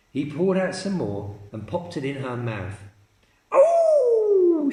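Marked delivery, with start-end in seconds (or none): 3.5-4.7 very high loud shriek; scream. Acted. Grandma shrieking as the medicine hits her. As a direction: shriek as she shoots up. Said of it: "she screamed".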